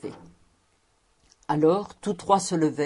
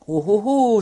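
louder, higher cry of surprise; amazed exclamation; 4 semitones higher, 7 dB louder than usual. A narrator's reading. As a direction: exclaim in swelling astonishment. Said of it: "s'écria Anne".

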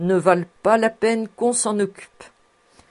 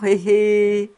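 put-on toothless old woman's knowing cackle, louder; sly cackle; 4 semitones higher, 6 dB louder than usual. A narrator's reading. Old housekeeper teasing Fritz about a pretty girl, sly cackle. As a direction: cackle slyly at the young master. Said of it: "Elle riait de sa bouche édentée".

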